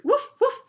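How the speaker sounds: high, loud dog woofs; sudden yappy double woof; barking. Acted. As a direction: bark like an excitable little dog. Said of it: "to see who was barking".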